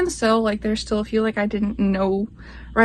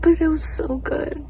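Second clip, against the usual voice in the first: whisper; 6 semitones higher than usual. Real, not acted. Whispered gush about the book.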